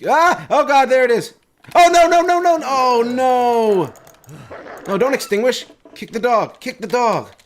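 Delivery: panicked high shouting; yelling while running away. Real, not acted. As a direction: scream at the dog in panic. Attacked by wolf, fleeing.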